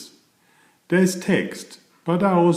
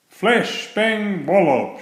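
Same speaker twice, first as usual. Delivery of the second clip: shouted; 9 semitones higher, 3 dB louder, slower than usual. Acted. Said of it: "shouted Thomas".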